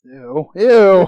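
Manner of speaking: loud, drawn-out disgusted ew. Real, not acted. Reacting to a crude joke, loud disgust. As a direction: recoil from the joke.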